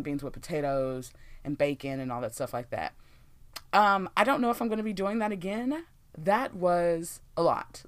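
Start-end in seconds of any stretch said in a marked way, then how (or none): 6.2-7.8 drawn-out exasperation. Real, not acted. Exhausted by cooking.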